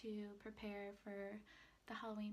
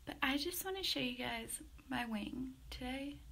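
usual speaking voice

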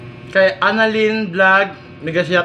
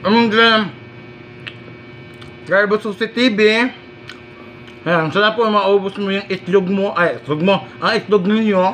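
chewing while talking; speaking between bites; mouth full. Real, not acted.

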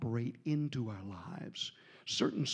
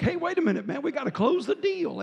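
calling after; 12 semitones higher, 6 dB louder than usual. Retold or imitated. Calling after men running off.

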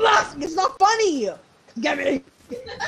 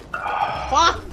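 ordinary talk

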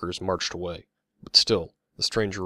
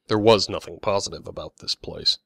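usual speaking voice

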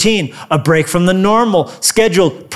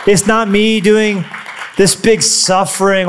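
usual speaking voice

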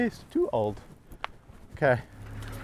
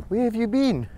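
dog talk; 9 semitones higher than usual. Real, not acted. Greeting the dog after time away.

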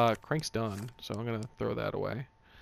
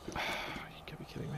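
whispered disbelief; 11 dB quieter, much faster than usual. Real, not acted. Muttered disbelief.

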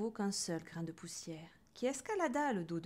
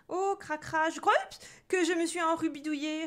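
high little voice, broken by a hiccup; piping, hiccupy little boy; small boy's voice; 8 semitones higher, 6 dB louder than usual. A narrator's reading. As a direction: answer in a tiny high child voice. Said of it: "répondit Pierre d'une petite voix".

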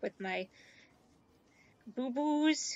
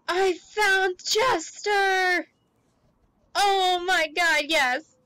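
overjoyed shouting; 4 semitones higher, 7 dB louder than usual. Real, not acted. Streamer finds Chester for the first time, overjoyed shouting. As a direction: shout it like you just won the lottery.

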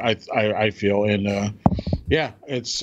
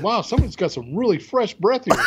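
quoted coworker; 8 semitones higher than usual. Retold or imitated. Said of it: "somebody said".

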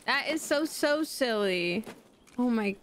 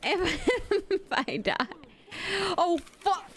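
panicking; 7 semitones higher than usual. Real, not acted. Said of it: "I'm panicking".

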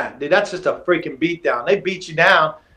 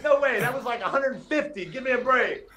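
mock protest; 8 semitones higher than usual. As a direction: complain about the unfair count. Protesting the count.

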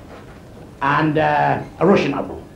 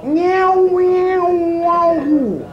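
high, drawn-out mock cat meows; 10 semitones higher, much slower than usual. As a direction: yowl like cats on a wall. Cats yowling on the wall.

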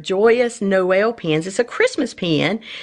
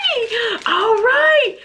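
thrilled. Real, not acted. Collector seeing anniversary pins, high thrilled gushing. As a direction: gush with real excitement, voice up high. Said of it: "I'm so excited".

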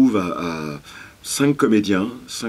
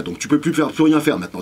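ordinary delivery

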